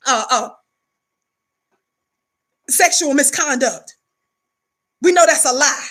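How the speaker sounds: normal talking voice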